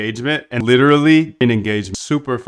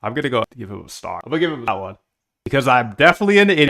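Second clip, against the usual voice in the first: ordinary talk, nothing marked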